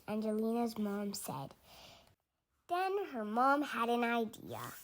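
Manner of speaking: ordinary delivery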